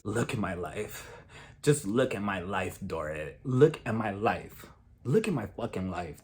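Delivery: low, dragging mock despair; mimicking Erica's bitter rant. Recapping Erica pleading to Dorit, low mock despair.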